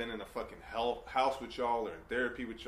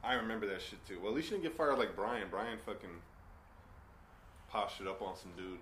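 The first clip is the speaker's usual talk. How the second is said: ordinary talk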